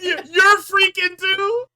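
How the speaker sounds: high, loud outraged yell; imitating a crowd member losing it at freaking; incredulous shout. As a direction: shout it in mock disbelief. Mock outrage at Drew's catchphrase.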